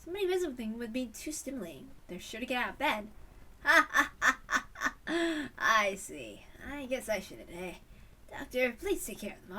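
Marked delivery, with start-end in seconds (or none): 3.6-5.5 loud hearty laughter; a big open belly laugh. Acted. Laughing at the doctor.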